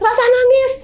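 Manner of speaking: irritated shout at a weeping spirit; snapping at the ghost. Retold or imitated. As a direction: tell the crying ghost off. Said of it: "aku bilang gitu".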